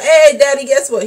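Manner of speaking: loud, high sing-song call; calling out to dad. Retold or imitated. Announcing news to dad, loud and high. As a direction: call out to get dad's attention. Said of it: "Come on through, like".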